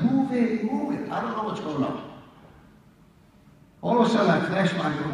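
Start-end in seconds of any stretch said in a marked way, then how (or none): 0.1-1.1 high, urgent mock yell, pushing people along; retold yell. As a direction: call it out high and urgent, like clearing a stairwell. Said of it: "and I'm yelling".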